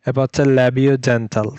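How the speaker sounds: ordinary talk, nothing marked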